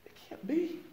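high, soft, rising "It can't be." barely breathed; stunned whisper of disbelief. Retold or imitated. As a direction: ask it in shock as if you can't grasp it. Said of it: "And I was like".

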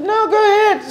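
mock girly permission; imitating Mina. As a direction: squeak it out like her agreeing. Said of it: "She's like".

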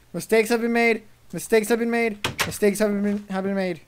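loud, high repeated lament; frantic chant of regret; panicked yelling. Real, not acted. Player escaping after blunder, loud panicked yelling.

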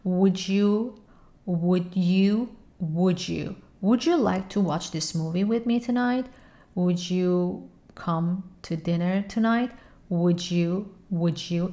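"would you" blended into "wouldja"; slow "wouldja" demo. Shown as a demonstration. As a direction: let the d and y merge into a j sound.

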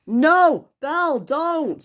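high, drawn-out cry to stop; acted high-pitched plea; alarmed protest. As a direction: call desperately after someone leaving. Gordon tries to stop Belle.

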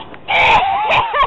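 sudden high laugh; high burst of laughter. Real, not acted. Laughing at croc escape.

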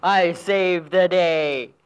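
slow, proud cow voice; boastful cow. Acted. Jersey cow boasting it saved the day. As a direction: gloat it like the cow.

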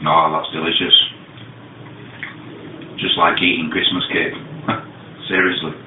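speaking between bites; talking while chewing. Real, not acted. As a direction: mouth partly full of food. Seen 0: Holds fudge at mouth throughout, bites near end, mouth likely partly full.

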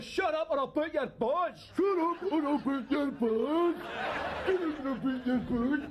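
squeaky tough-guy threat, laughing; stupid high voice. Acted. Thug threatening in a squeaky high voice, laughing. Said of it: "talking in a stupid voice".

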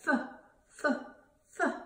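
"F" as a short fuh, not the letter name; letter sound demo. Shown as a demonstration. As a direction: sound out "F" in separate puffs. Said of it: "Francie Fish says".

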